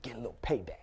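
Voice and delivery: conspiratorial whisper; sly whispered payback. Acted. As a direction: whisper it like a secret plan. Gleeful aside about revenge.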